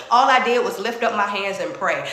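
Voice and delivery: ordinary talk, nothing marked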